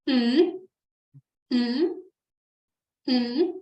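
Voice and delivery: "Mm" as the French ON vowel, hummed through the nose; French nasal ON. Shown as a demonstration. Teacher demonstrates the O N sound, spaced nasal "mm". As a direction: let each "mm" tickle in your nose, well separated. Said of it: "it comes from your nose".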